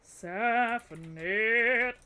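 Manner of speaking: "Safinet!" stretched very slowly; drawn-out call. Real, not acted. Streamer calling out the raider's name, very slow.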